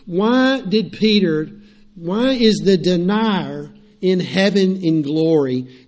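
ordinary talk, nothing marked

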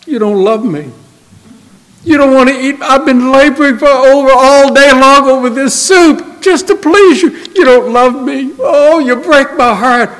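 high, wounded mother laying on the guilt. Acted. Missus Rosenberg reproaching her son who won't eat, hurt high wail. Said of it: "How does Missus Rosenberg respond?".

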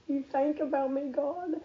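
high-pitched, moved wonder; high, halting retold astonishment; retold disbelief. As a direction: ask God in humbled amazement. Retold amazed question to God.

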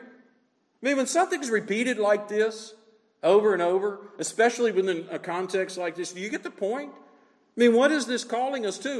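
usual speaking voice

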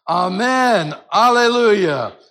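loud, slow Alleluia; praising loudly with the elders; crying out. Shown as a demonstration. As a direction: lift it up with full voice. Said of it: "A little, little more crying out".

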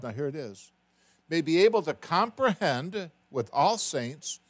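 loud, slow solemn Bible reading; scripture reading. Acted. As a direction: proclaim the verses slowly to the room. Reads scripture aloud.

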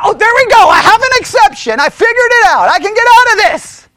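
high, loud eureka cry; exaggerated triumph at finding a loophole; mock elation. Believer who figured out an exception, mock excited triumph.

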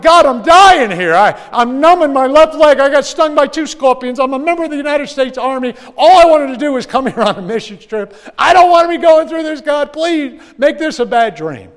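high, loud desperate pleading to God; frantic high-pitched prayer; panicked prayer. Retold or imitated. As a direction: beg the heavens, voice cracking high.

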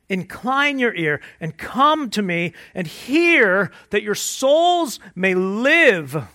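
full-force preaching. Acted. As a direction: preach it out, rising and pushing harder.

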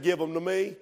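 soft, low, calm; quiet Jesus. Retold or imitated. Jesus asking disciples for the loaves, soft and low. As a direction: hand it over gently, almost hushed.

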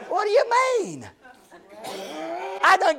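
hammed-up incredulous outburst at a neighbor; mock exasperation. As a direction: squeal it in joking disbelief. Preacher mock-exasperated at Alice.

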